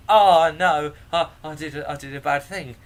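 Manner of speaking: loud, high mock dismay; hammed-up guilty outburst; dismayed oops. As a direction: act out an oh-no moment of realizing the blunder. Retells realizing his own mistake.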